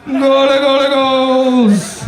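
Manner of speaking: high, drawn-out stadium yell; soccer goal call. Acted. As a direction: shout it like a soccer announcer.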